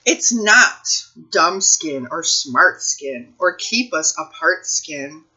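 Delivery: ordinary delivery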